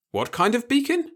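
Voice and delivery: sharp high puzzled question; wait, what kind. Acted. The repairman asks, puzzled.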